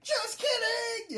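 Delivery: very high, slow teasing squeal; cheeky Usopp. Retold or imitated. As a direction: drag out the prank reveal gleefully. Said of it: "big panel of him just being like".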